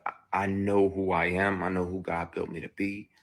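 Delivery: ordinary talk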